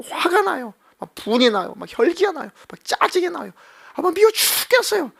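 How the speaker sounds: 높은 목소리로 하나님께 투정을 부림; 속상한 마음을 하소연하듯 올려서; 투정하는 기도. Acted. Acted whining prayer to God. (하나님께 짜증난다고 투정하는 기도, 높은 목소리)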